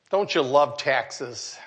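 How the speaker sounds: slow, low sarcasm; dryly sarcastic question, taken slowly and low. Real, not acted. Pastor joking to congregation about paying taxes, sarcastic. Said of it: "I was being sarcastic".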